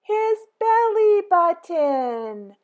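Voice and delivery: high, loud, slow delighted reveal; gleeful surprise; belly button reveal. A narrator's reading. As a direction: unveil it like a happy surprise.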